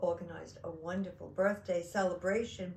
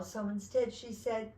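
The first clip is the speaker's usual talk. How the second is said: usual speaking voice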